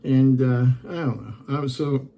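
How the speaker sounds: ordinary delivery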